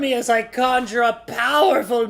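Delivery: booming villain proclamation, high and loud; cold rasping evil emperor. Pretend. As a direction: gloat like a power-mad tyrant.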